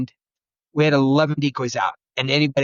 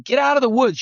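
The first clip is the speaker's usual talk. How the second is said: higher, sharp mock scold; retold scolding; 9 semitones higher than usual. Hunter scolds a noisy caller in the woods, mock angry shout. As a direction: bark it at an intruder in the woods. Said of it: "I go over to scold that guy".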